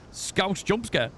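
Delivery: usual speaking voice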